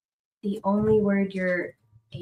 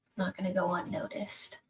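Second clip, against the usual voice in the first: whispering; 11 dB quieter than usual. Acted. Ominous GM line.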